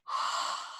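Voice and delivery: quiet unvoiced sigh out; just breathe it all out softly; soft breathy sigh. Shown as a demonstration. Guide exhaling with the listener, soft quiet sigh. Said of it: "Take a nice, comfortable breath".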